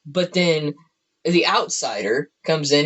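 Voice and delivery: ordinary talk, nothing marked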